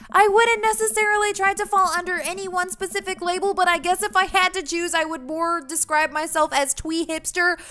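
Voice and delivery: put-on high, loud teenage yell; yelling back. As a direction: call the answer back across a distance. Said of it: "[name] yells back".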